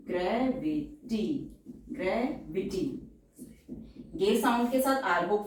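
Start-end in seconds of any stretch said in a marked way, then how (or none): 0.1-2.8 gravity drawn out slowly and softly; slow and syllabled. Shown as a demonstration.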